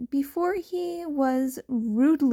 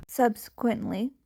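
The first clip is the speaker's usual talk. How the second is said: plain reading voice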